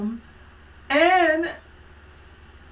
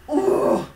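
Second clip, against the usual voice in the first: angry groan; 10 semitones higher, 6 dB louder than usual. Retold or imitated. Angry in the restroom.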